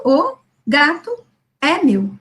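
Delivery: o gato é meu read slowly, with a pause before é meu; slow child reading. Shown as a demonstration. Teacher demonstrating the child's halting reading, slow. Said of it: "a leitura é assim".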